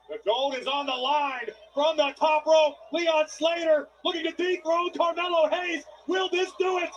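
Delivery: loud, high, rising play-by-play; wrestling commentator. Real, not acted. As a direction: build the tension for the finish. Live play-by-play build.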